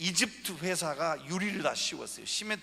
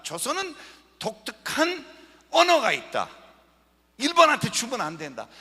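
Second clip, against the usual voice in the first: rising, louder insistence on each phrase; building to a loud, emphatic plea; fervent declaration; 6 semitones higher, 7 dB louder than usual. Retold or imitated. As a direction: proclaim it like a conviction you'd die for. Passionately voicing Allen's conviction.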